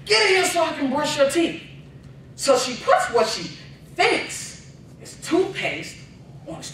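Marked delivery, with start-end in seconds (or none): 0.1-1.6 mom calling out. Retold or imitated. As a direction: call the kid in from the next room. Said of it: "calls my brother".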